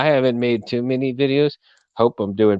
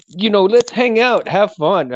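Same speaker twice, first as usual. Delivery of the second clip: excited; 9 semitones higher, 4 dB louder than usual. Real, not acted. Upbeat invitation.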